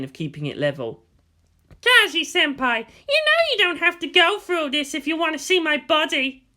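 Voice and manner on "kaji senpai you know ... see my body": high, coy schoolgirl voice. Acted. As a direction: bait Kaji sweetly.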